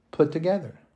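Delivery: normal talking voice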